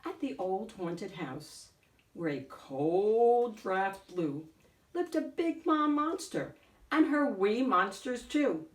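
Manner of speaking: usual speaking voice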